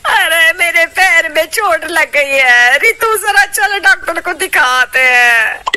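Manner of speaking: loud, slow, whimpering complaint; whining in pain. Acted. Injured mother-in-law whines to Ritu, loud and slow. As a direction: moan about the hurt foot.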